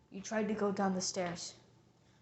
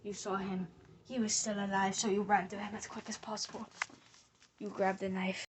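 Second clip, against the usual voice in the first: ordinary talk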